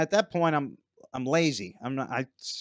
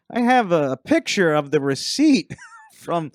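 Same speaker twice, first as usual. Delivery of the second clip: loud, high, drawn-out retort; crowing retold comeback; triumphant comeback; 6 semitones higher, 8 dB louder, slower than usual. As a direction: land the winning proof slowly and loudly.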